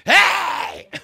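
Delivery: very high, loud hammed-up church shout; mock hypocrite hollering in church; mock worship whoop. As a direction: ham up a showy worshipper. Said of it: "pray the loudest in the service".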